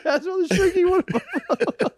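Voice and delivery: cracking up mid-quote; laughing quote. Retold or imitated. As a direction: squeeze the words out through laughter. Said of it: "He said".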